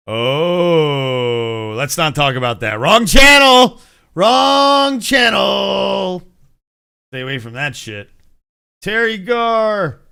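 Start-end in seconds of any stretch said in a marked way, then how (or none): 2.8-3.8 louder, higher, a bit slow "Wrong channel"; playful louder call of "Wrong channel"; mock warning. Quickly steering off topic. 4.2-6.2 "Wrong channel" stretched very slow and called out; slow, sing-song mock shout of "Wrong channel"; drawn-out call. Repeating the warning drawn out.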